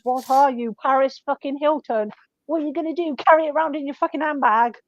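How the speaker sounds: loud, rising scornful rant; mock outrage. Shop worker mocking a chihuahua owner, outraged. Retold telling-off of dog owner.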